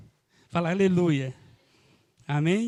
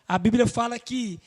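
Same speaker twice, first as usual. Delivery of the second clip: normal talking voice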